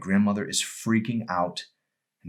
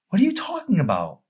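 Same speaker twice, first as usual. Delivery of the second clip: surprised; 12 semitones higher, 5 dB louder than usual. Acted. Emery confused, alarmed.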